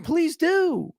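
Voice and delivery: very high, indignant retold comeback; defiant retort. Guest answers manager's threat to call police, defiant. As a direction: dare them in a high, exasperated voice.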